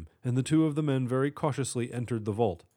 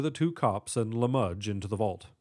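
ordinary delivery, nothing marked

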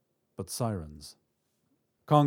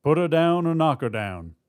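loud, raised-pitch instruction; shouted order; 6 semitones higher, 6 dB louder than usual. A narrator's reading. Robber at teller window to partner, shouted order. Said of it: "the other man shouted".

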